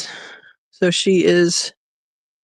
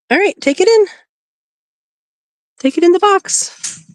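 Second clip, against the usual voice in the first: much higher, sing-song coaxing; high pet-talk coaxing; 11 semitones higher than usual. Real, not acted. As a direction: owner coaxing her rabbit into the box, very high. Coaxing rabbit into nest box.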